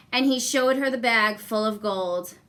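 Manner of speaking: plain reading voice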